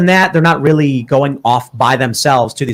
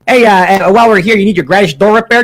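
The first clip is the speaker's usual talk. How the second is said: put-on Chicago accent; 4 semitones higher, 3 dB louder than usual. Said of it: "do your Chicago accents".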